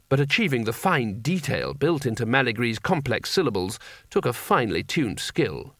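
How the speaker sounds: plain reading voice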